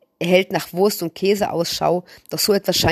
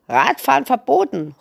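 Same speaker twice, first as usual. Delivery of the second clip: put-on higher, scratchy old woman barking a rule; a grumpy granny calling over the fence; 6 semitones higher than usual. A narrator's reading. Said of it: "als eine kratzige Stimme".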